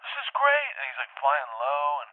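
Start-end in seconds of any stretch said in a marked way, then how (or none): 0.1-0.7 very high, excited call; acted delight. Dreamer to pilot over Brazil, acted delight. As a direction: squeal it back in delight.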